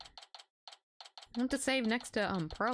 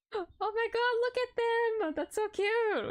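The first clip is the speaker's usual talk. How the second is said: high, gushing squeal; excited squeal; 13 semitones higher, slower than usual. Real, not acted. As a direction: squeal over something cute. Streamer gushing over characters.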